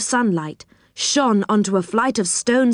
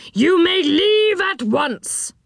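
high, slow, drawn-out permission; grand dismissal; 10 semitones higher, slower than usual. A narrator's reading. Enchanter waves them to the door, grandly dismissive. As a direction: stretch it out as a lordly dismissal.

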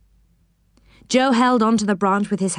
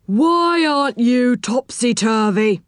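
slow, drawn-out stern voice; slow, officious reprimand; stern policeman; 3 semitones higher than usual. A narrator's reading. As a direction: ask it sternly, stretching the words. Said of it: "he asked in a stern voice".

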